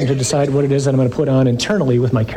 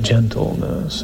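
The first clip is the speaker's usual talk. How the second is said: gentle ironic; 4 semitones lower, slower than usual. Shown as a demonstration. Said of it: "Real manly word, isn't it?".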